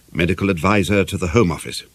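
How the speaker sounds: usual speaking voice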